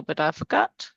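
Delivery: ordinary talk, nothing marked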